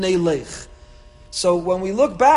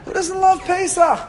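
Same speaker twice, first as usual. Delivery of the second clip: high and bright but less loud; excited; 12 semitones higher, 5 dB louder than usual. Real, not acted. Repeats the question.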